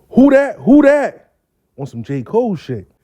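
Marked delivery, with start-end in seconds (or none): 0.1-1.3 high, loud mock hollers; admiring hollers. Women spotting the groomed man, high loud hollers. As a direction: call it out like an impressed crowd.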